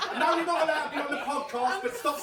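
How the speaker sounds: high-pitched, laughing tell-off; exasperated, joking ban; mock scolding. Banning Imogen from the podcast, mock scolding.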